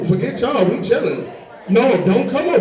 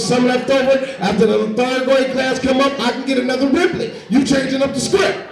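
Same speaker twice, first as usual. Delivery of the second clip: put-on whiny kid; 6 semitones higher than usual. Little boy begging the lunch lady for seconds. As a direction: plead for another riblet like a wronged kid.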